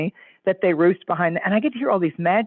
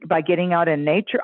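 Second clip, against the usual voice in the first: normal talking voice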